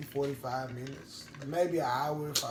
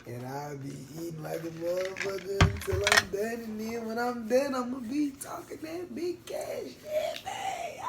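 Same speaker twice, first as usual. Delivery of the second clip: hype building to a shout; 8 semitones higher, 6 dB louder than usual. Real, not acted. Hyping up his post-meal talk.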